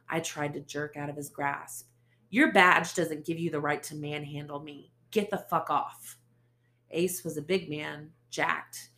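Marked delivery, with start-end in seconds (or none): 2.3-6.2 loud defiant demand; woman fighting off a cop's grip, loud; defiant anger. Acted. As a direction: stand your ground loudly. Narrator jerks from grasp.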